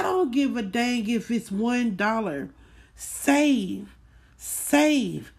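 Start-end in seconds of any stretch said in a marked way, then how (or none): none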